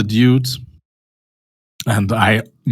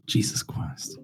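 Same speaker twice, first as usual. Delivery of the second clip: whispered curse; 6 semitones lower, 10 dB quieter, slower than usual. Real, not acted. Frustrated under breath.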